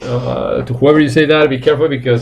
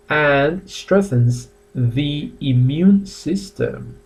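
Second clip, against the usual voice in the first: slow, emphatic fact; slower than usual. Shown as a demonstration. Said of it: "teacher reading a fact".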